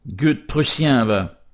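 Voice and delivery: play-acted fury at the enemy; enraged curse. A narrator's reading. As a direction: spit out the insult through gritted teeth. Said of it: "murmurait entre ses dents d'un air rageur".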